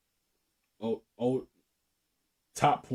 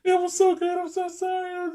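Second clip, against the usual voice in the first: much higher, swooning falsetto woman; mock woman's voice. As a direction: imitate her gushing in a high voice. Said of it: "She was like".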